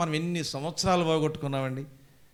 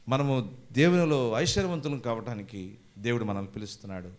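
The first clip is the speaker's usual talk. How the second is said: normal talking voice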